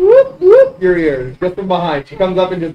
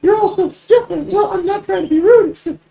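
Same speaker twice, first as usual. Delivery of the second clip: put-on very high girlish falsetto; high-pitched awkward apology; flustered, apologetic girl; 13 semitones higher than usual. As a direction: explain yourself in a tiny high voice. Game master plays flustered girl.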